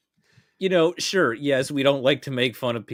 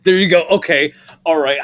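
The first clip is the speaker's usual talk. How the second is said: excited; 6 semitones higher, 11 dB louder than usual. Real, not acted. Excited about a gross idea.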